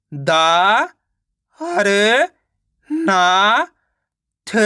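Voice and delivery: "दा, हर, ना, थ" one by one with pauses; teacher spelling out "दा, हर, ना, थ"; sounding out syllables. Shown as a demonstration. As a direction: stretch "दा" and "ना", lifting their ends. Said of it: "तो मिलके बन जाएगा केदारनाथ".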